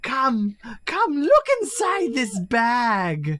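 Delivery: high, slow coaxing voice; creepy Santa. Acted. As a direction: coax the child into the bag. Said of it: "We'll be like".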